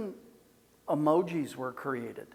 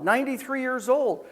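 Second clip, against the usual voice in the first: usual speaking voice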